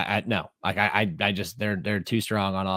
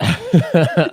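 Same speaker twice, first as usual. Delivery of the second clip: laughing through it, higher and louder; 7 semitones higher, 8 dB louder than usual. Real, not acted. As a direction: break into laughter on the word. Laughing at the accent joke.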